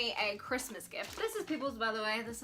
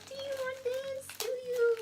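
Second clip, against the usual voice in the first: cooing pet voice, higher and light; high dog-talk voice; 10 semitones higher than usual. Real, not acted. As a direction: coo at the excited dogs, pitched way up. Talking to excited dogs.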